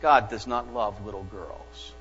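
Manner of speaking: put-on quiet, solemn child's answer; a hint of a grave little girl. Little girl solemnly telling the missionary, quiet and grave. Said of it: "replied very solemnly".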